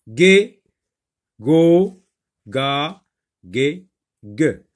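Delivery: "Gué, go, ga, gué, gue" one by one with gaps; reading out syllables. Shown as a demonstration. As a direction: read each syllable separately, settling as the list goes on. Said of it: "Alors, lis les syllabes".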